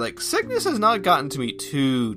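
normal talking voice

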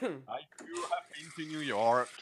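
slow, put-on American accent; badly faked New York accent; mock New York accent. Playing the Americanized newcomer, slow New York accent.

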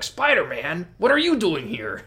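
imitating Hagar. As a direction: toss off Hagar's goofy line. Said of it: "he's like".

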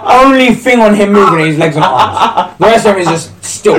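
ordinary delivery

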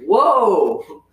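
loud, high, drawn "whoa"; re-enacted shock, pitch swooping up. Cashier reacting to manager's blunt remark, shocked whoa.

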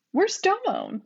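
high, puzzled ask; surprised question. Acted. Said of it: "surprised question".

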